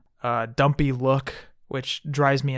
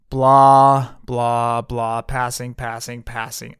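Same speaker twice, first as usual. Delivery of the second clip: bored dismissal; 3 semitones lower, 4 dB louder, much slower than usual. Real, not acted. Host skips through zombie figures he dislikes, bored dismissal. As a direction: wave the list away, uninterested. Skipping through a dull list.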